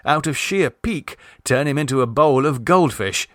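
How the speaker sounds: ordinary delivery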